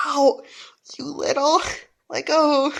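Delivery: loud pained shout; yelling in pain. Acted. Bully shouts at the boy biting him, in pain. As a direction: howl it as the teeth sink in.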